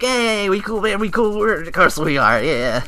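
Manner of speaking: hammed-up bro reassurance; mock buddy voice. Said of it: "like, a little, like".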